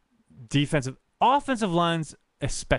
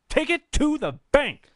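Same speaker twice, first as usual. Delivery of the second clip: "Take it to the bank" pitched much higher and louder; hyped-up repeat; 11 semitones higher, 3 dB louder than usual. Real, not acted. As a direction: sell it like a hype man. Hyping prediction, repeated higher.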